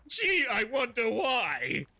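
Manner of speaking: hammed-up furious Helios; sarcastic Helios. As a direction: drip sarcasm as the angry head.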